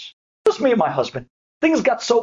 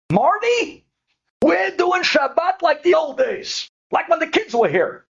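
excited call to her husband; 10 semitones higher, slower than usual. Retold or imitated. Wife tells her husband they're keeping Shabbat, a high, excited shout. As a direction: proclaim it like big news to Marty.

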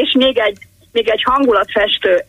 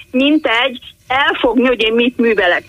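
ordinary talk

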